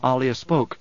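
usual speaking voice

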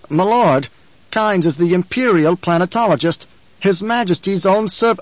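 a frightened underling hurrying to please; anxious guard captain. Acted. Captain objects, cut off.

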